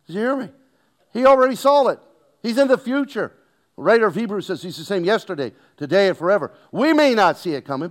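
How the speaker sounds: loud, high, driving preacher's cry; preaching at full force. Acted. Preacher to congregation about God seeing ahead, full-force preaching. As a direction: lift the room with a shouted preaching climb.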